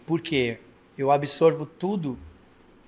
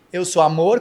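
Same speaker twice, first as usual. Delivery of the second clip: said angrily; 3 semitones higher, 7 dB louder than usual. Shown as a demonstration. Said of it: "com cara de raiva".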